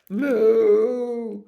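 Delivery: big long bovine bellow; cow moo. Acted. Cow moos while running.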